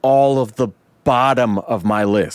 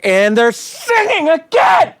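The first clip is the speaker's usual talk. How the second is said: much higher, loud half-shouted complaint; exasperated cry; 4 dB louder than usual. Retold or imitated. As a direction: wail it like you can't take another song. Exasperated rant at film.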